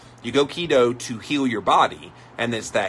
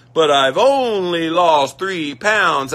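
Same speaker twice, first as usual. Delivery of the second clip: higher, louder, slow mock lament; mock dieter; 8 semitones higher, 9 dB louder, slower than usual. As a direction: play the dieter fixated on the scale. Quoting a scale-obsessed dieter.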